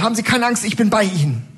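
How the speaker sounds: a hint of an overdramatic doctor; hammed-up doctor.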